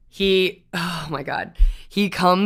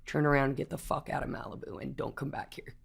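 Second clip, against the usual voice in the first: cop dismissal; 4 semitones lower, 13 dB quieter than usual. Retold or imitated. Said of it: "and he goes".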